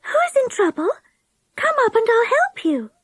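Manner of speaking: high, airy fairy voice; silvery voice. A narrator's reading. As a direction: gently offer help. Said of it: "A silvery voice called".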